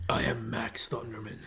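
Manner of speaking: low, whispery villain voice; Max Thunderman. Acted. Fan imitates the evil brother from the show, low and whispery. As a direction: intone it low and husky like an evil character.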